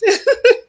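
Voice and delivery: short high burst of laughter; a quick giggle at yourself. Real, not acted. Laughs at own joke.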